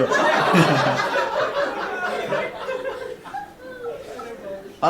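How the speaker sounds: very high squealing laugh; real laughing fit. Real, not acted. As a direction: crack up helplessly.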